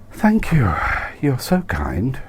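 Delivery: breathy voice with high frilly lift; breathed. A narrator's reading. Candy breathes thanks to Nigel in the lift, breathy and high. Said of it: "she breathed in a voice set someth".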